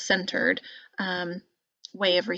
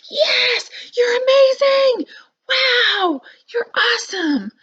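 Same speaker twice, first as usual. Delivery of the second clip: very high, loud screaming cheer; 9 dB louder, slower than usual. Shown as a demonstration. Parent at a basketball game screaming praise at the kid. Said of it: "screaming that".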